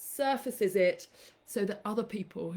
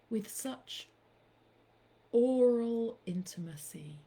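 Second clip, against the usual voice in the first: poem reading. Acted. Reciting an ode to Blind Boy, slow and hushed. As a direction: savour each word like a love poem. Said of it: "My last poem".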